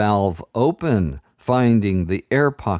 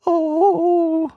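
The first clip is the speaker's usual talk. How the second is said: put-on terrified high little-girl wail. A narrator's reading. Frightened girl wailing before the enchanter. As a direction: wail in terror. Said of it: "her voice cried".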